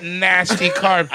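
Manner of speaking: usual speaking voice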